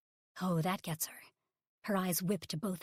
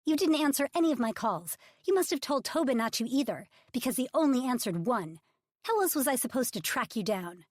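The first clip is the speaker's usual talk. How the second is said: high, loud, accusing demand; angry accusation; 5 semitones higher, 6 dB louder than usual. Acted. Publicist scolding Ridge for dodging calls, angry and loud. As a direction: let the frustration spill out.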